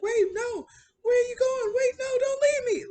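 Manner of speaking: very high, loud, panicked begging; mock terrified high shout; desperate plea. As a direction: yell after him not to be left behind. Injured man begs not leave.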